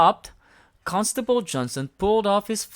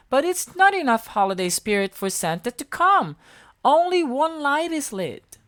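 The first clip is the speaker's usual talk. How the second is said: higher, a little louder tree voice; Holly voice; 11 semitones higher, 5 dB louder than usual. A narrator's reading. Holly telling them about spirit, high and earnest. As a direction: explain gently as a magic tree. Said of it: "said Holly".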